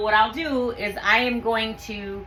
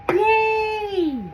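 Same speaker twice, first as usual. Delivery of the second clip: high, bright little cheer; 11 semitones higher than usual. Real, not acted. Jar fits at last, cheering. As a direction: celebrate the win.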